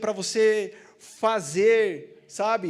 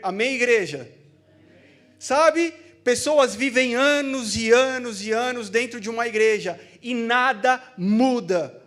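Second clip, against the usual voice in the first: preaching; 3 semitones higher, 6 dB louder than usual. Acted. Preaching at full force.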